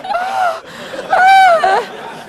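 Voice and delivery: very high, drawn-out swooning cry; exaggerated falsetto "ah"; mock female squeal. Mimics her reaction in high squeal.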